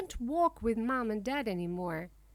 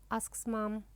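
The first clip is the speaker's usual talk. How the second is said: ordinary delivery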